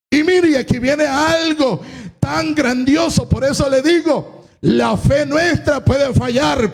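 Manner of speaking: high-pitched, driving sermon delivery; impassioned preaching. Real, not acted. Preacher telling the congregation how Peter walked to Jesus, impassioned preaching. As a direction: preach it with full conviction.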